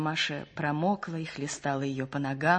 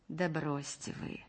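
soft, reluctant dismissal; displeased; 11 dB quieter than usual. A narrator's reading. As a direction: brush it off quietly, displeased. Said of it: "недовольно сказал лётчик".

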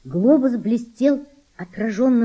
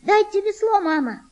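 put-on angry girl; 12 semitones higher than usual. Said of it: "сердито сказала она".

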